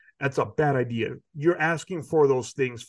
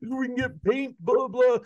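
mock guy asking for paint; 8 semitones higher than usual. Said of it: "out the window talking to the guy".

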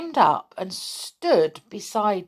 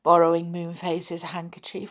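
ordinary delivery

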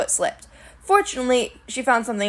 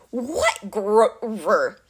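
very slow, dim monster voice; glowering Cyclops; much slower than usual. A narrator's reading. Suspicious Cyclops questions his captive, slow glowering voice. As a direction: draw each word out like a puzzled monster. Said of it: "Polyphemus glowered".

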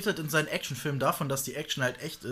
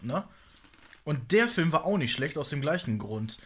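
ordinary talk, nothing marked

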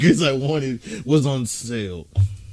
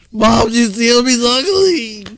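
put-on higher whiny kid voice; mock whiny daughter; 7 semitones higher than usual. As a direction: complain to mom like a sulky little girl. Playing daughter complaining.

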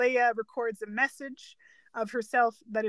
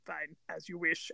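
reluctant Vader; 8 semitones lower, 11 dB quieter than usual. Retold or imitated. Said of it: "And he's like".

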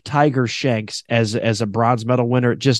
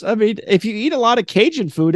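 ordinary talk

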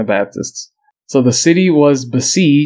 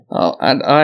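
ordinary delivery

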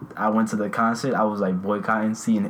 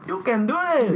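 high mock cheerleader voice; mock pep talk; 13 semitones higher than usual. Friends hyping a drinker up, high mock cheer. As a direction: chirp it up high like a hype coach.

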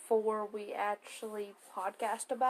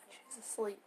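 whispering over a crib; 2 semitones lower, 11 dB quieter than usual. Real, not acted. Baby asleep nearby.

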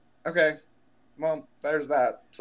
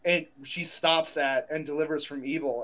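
normal talking voice

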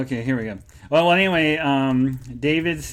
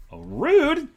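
drawn-out high indignant "rude"; mock offended; 12 semitones higher, 3 dB louder than usual. Playful indignation at a viewer's cheeky chat comment. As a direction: pretend to be insulted by the comment.

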